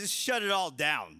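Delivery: ordinary delivery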